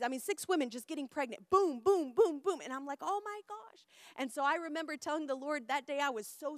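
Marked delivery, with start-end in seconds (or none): 1.5-2.6 loud, high, punchy booms; animated sound-effect count; excited booms. Acted. Rapid-fire pregnancies in excited story. 3.0-3.9 retold stunned delight; amazed gasp of words. Amazed reaction to the pregnancies.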